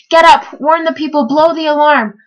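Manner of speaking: high, urgent calling out; urgent wake-up call. A narrator's reading. Bartholomew waking the snoring trumpeter, urgent. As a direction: rouse a sleeper in alarm.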